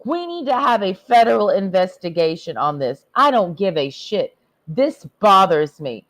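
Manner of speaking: ordinary talk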